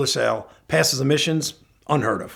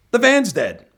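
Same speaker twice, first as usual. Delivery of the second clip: attempted English accent, pitched up high; 9 semitones higher, 4 dB louder, slower than usual. Buddy phones to report the breakdown, mock English accent. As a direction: imitate a British buddy, higher-pitched. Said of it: "with his English accent".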